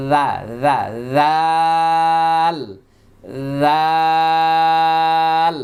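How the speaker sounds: "জাল" drawn out loudly with the tongue tip between the teeth; Arabic thal. Shown as a demonstration. Teacher showing students ذال with the tongue tip at the teeth, drawn out. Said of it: "জিহ্বার আগা দাঁতের সঙ্গে".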